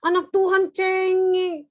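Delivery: loud, high, drawn-out jeer; hammed-up singsong reproach; mock taunting. Parent shaming a child for crying, mock-taunting.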